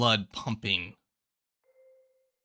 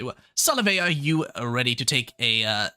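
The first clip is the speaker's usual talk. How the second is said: high-pitched, louder professor; 5 semitones higher, 3 dB louder than usual. Acted. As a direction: lift the voice well up.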